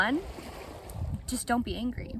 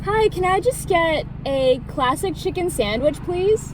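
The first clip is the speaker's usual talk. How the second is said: raised, higher voice into the speaker box; loud ordering; 7 semitones higher, 7 dB louder than usual. Real, not acted. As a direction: call the order out clearly to the intercom. Ordering into drive-thru speaker.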